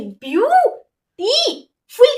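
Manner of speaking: "Beautiful" broken up with long gaps between syllables. Shown as a demonstration. Teacher asking students to count, each syllable set apart slowly. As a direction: say "beautiful" as view... टी... फुल, each beat held. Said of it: "तो कितने syllable हुई".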